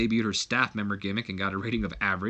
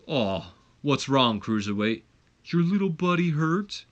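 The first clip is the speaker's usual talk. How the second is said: slow, mocking big-man sneer; lightly voiced giant taunting the smaller man; 3 semitones higher than usual.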